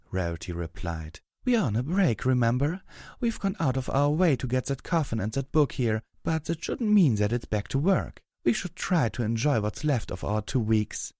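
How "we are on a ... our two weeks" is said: higher, lively character voice; Rarity's insistent voice. Acted. Rarity insists they are on a break, high insistent voice. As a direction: brush off a friend's worry in a raised pitch.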